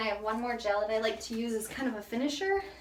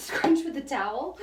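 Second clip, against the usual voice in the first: ordinary talk, nothing marked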